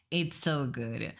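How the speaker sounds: usual speaking voice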